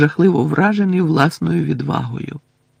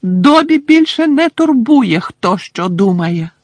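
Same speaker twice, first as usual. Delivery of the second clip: high, slow squeaky elf voice; defiant house-elf; 9 semitones higher, 7 dB louder, slower than usual. Acted. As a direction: squeak it out stubbornly. Dobby defiant.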